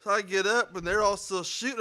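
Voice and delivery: ordinary delivery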